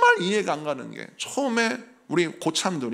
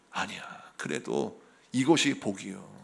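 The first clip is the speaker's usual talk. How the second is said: low, soft, slow insistence; quiet firm father; 10 semitones lower, 6 dB quieter than usual. Retold or imitated. Father quietly insists to his son it is a blessing. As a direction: hold your ground gently.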